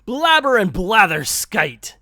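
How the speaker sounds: corrected Scrooge line. Acted. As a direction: nail the catchphrase on the retry. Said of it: "let me try that again".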